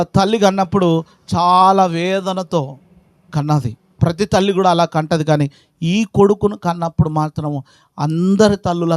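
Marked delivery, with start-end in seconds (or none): none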